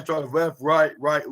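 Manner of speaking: normal talking voice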